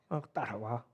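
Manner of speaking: normal talking voice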